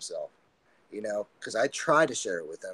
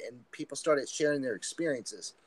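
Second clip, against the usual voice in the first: normal talking voice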